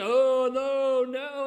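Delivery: loud indignant denial, hammed up; protesting disciples.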